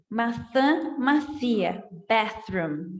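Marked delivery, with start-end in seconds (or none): 0.1-1.8 "Maçã macia" with a lisped th-like s. Shown as a demonstration. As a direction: say "Maçã macia" with tongue between teeth. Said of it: "falar maçã macia". ("maçã macia" com língua presa)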